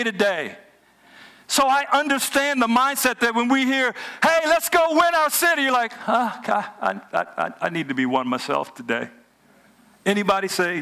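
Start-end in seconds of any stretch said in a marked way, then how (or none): 4.2-5.6 high, eager rallying cry; upbeat, high-pitched exhortation; rousing rally call. Acted. Rallying call the congregation hears. 5.9-9.1 acted low, faltering reluctance; weary congregant begging off; reluctant reply.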